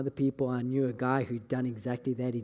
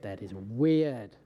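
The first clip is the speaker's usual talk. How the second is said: slow drawn-out disbelief; 5 semitones lower, much slower than usual. Retold or imitated. Pastor retelling a second identical prophecy, slow stunned disbelief. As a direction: stretch each word out slowly, half to himself.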